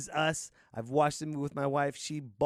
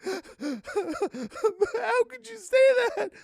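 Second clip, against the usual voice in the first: mock sobbing fan; 6 dB louder than usual. Mocking offended crying fan.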